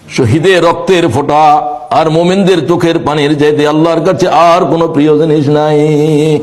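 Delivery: melodic waz preaching. Real, not acted. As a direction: chant it to a congregation, stretching final vowels. Preacher chants his waz. (সুরেলা ওয়াজ, শেষ শব্দে টান)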